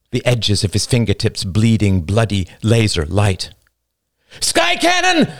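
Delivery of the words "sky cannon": very high, full-voiced scream of warning; alarmed scream. A narrator's reading. Man warning the others of the sky cannon, screamed. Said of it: "screamed a man".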